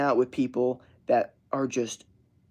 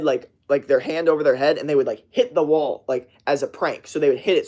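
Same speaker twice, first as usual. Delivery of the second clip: ordinary talk, nothing marked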